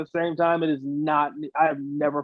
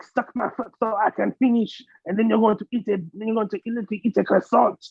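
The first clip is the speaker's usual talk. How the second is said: higher, hammed-up French accent; mock French accent; 4 semitones higher, faster than usual. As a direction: play a crude Frenchwoman in cartoon French. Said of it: "how we do it in French".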